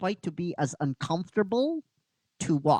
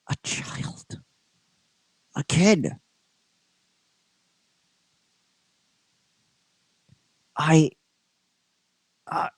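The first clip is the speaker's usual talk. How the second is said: halting, hushed then forceful; Goldar's dawning realisation. Acted. Goldar looks at the girl and falters, hushed and halting. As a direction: stop between phrases, dropping toward a whisper on the child.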